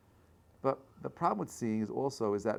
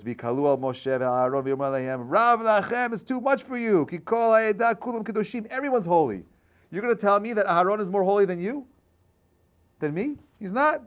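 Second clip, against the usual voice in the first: loud, high, indignant challenge; angry crowd; 9 semitones higher, 8 dB louder than usual. Acted. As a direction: hurl the accusation at Moses, much higher and louder. Korach's crowd confronts Moses.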